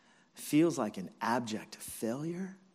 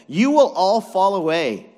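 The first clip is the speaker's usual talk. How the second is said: loud, higher-pitched pronouncement; raised preacher's voice voicing a warning; forceful prediction; 7 semitones higher, 13 dB louder than usual. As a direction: declare it forcefully to the whole group. Jesus predicts the disciples' desertion.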